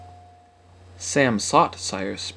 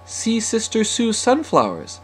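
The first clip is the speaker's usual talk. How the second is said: put-on high-pitched, chatty girl's remark; sociable remark; 10 semitones higher than usual. A narrator's reading. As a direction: make light conversation in a higher voice. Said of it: "said Sophia socially".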